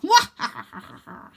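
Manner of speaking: breathy maniacal cackle; mad gurgling laugh. Acted. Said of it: "a mad gurgling laugh".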